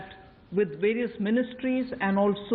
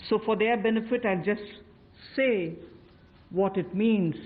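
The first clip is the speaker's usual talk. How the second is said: ordinary talk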